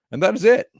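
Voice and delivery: high, loud "that is it"; excited sign-off. Real, not acted. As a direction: announce the finish with a burst.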